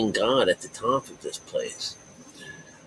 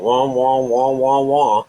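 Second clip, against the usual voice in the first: mock wailing wah, a bit louder and higher; imitated wah sounds; 4 semitones higher, 6 dB louder, slower than usual. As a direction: play the wah wah like a mocking horn. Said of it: "I went like this. I went".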